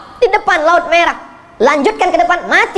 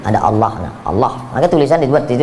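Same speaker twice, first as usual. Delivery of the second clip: ordinary talk, nothing marked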